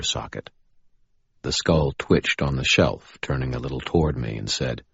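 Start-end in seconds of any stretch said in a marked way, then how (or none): none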